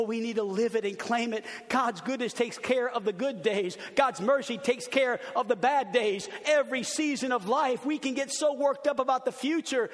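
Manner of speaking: ordinary delivery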